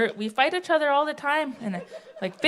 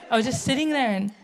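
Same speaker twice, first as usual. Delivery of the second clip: normal talking voice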